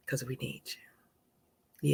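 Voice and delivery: slow breathy whisper, almost secret. Real, not acted. Host telling the men watching that they are needed, whispered slowly. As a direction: lean in and whisper it to them.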